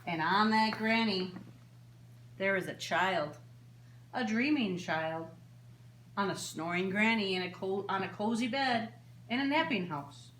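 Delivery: lilting singsong storybook read; reading to children. A narrator's reading. Librarian reading a bedtime book to children, gently lilting. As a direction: read it like story time for little ones. Reads picture book aloud.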